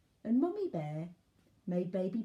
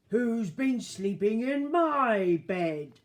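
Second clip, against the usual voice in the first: loud, slow, raised Daddy Bear roar; big gruff voice; 5 semitones higher, 10 dB louder, slower than usual. A narrator's reading. Daddy Bear looks at his bed, big gruff roar. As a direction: bellow it gruffly, stretching every word. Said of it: "said in a big gruff voice".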